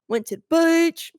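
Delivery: hammed-up Aussie with stretched vowels; broad Australian. Bragging about a beach day in slang, broad Australian. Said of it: "throw in all the Australian slang words".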